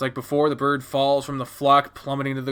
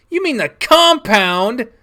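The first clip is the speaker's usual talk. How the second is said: high, loud, slow incredulous question; alarmed protest; 10 semitones higher, 8 dB louder, slower than usual. Acted. Child hearing dad's threat of the ranch, alarmed protest. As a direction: cry out in disbelief.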